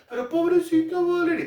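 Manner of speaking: high-pitched put-on pitying voice; mock pity. Someone feeling sorry for the paying daughter, high mock pity. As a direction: coo over her in a high, mocking voice.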